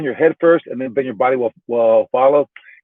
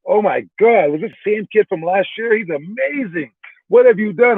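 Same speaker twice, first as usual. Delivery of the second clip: retold astonished praise, pitched up high; amazed referees; 6 semitones higher than usual. Referees to the coach about the transformed kid, raised amazed voice. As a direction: gush like stunned referees who can't believe the change.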